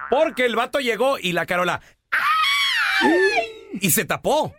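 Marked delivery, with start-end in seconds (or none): 2.1-3.8 very high falsetto squeal; comic startled "ay" in falsetto; high mock shriek. Carola surprised when the guy arrived, high mock shriek.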